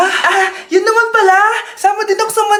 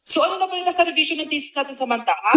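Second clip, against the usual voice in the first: quieter scolding; 9 dB quieter, faster than usual. Acted.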